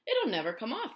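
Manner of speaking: high, loud, bright worm voice; cheerfully gloomy earthworm; brightly. A narrator's reading. Earthworm predicting doom to the others, bright high voice. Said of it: "the earthworm said brightly".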